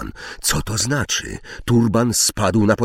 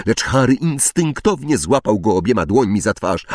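ordinary delivery

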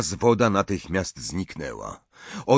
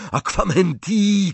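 shouted spell; 9 semitones higher, 4 dB louder than usual. A narrator's reading. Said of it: "krzyknął".

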